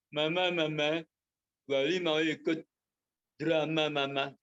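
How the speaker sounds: lightly voiced whiny child pleading with mama; a hint of a whiny boy asking mom; childish begging. Child Woli begging his mother.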